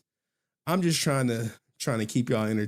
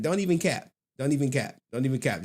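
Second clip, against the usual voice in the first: ordinary delivery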